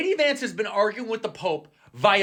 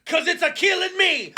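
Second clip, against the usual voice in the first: mock Italian punchline; 10 semitones higher than usual. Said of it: "the Pope is like".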